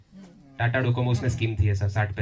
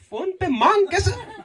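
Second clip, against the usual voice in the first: mock disbelief; 12 semitones higher, slower than usual. Baffled by phone wedding ritual.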